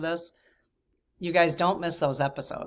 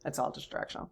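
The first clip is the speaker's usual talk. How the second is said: fading whisper; 10 dB quieter than usual. Real, not acted.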